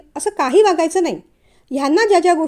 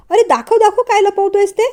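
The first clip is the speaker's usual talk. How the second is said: teasing; 6 semitones higher, 5 dB louder than usual. Acted. Brother teasing, grabbing at phone.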